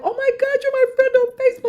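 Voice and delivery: fast, high-pitched mock squeal; gushing fan. Fan thrilled to be the author's Facebook friend, mock gushing. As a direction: imitate a starstruck fan gushing at speed.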